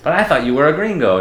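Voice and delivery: imitating a stranger's high, startled disbelief; mock surprise. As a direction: play the shocked stranger, pitch jumping up. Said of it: "they'll just say something like".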